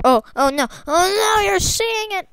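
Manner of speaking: high, loud panicked cry; panicked shout. Real, not acted. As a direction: yelp in alarm. Panic, chat sees screen.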